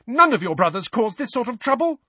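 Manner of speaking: high-pitched furious scolding; raging. A narrator's reading. Mother compares twins with their brothers, raging. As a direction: rage at the troublesome sons. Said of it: "Missus Weasley raged at the twins".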